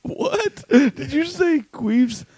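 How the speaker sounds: high, slow, disbelieving question; can't believe what you heard; surprised. Real, not acted. Host mishearing the album title, incredulous and high.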